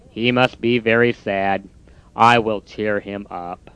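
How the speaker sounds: low, soft, slow defeated voice; glum Toad. Acted. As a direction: drag out the giving up. Toad's voice.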